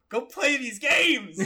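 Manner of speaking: slow, high, drawn-out plea; mock pleading. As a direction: beg him dramatically. Urging Sean to play.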